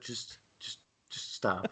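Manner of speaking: barely voiced, very soft and low; under the breath, trailing into near silence; hushed quote. She's telling Tom to stop, hushed. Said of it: "she's like".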